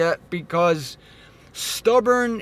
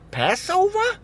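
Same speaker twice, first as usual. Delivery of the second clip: incredulous; 14 semitones higher than usual. Acted. Incredulous at keeping Passover.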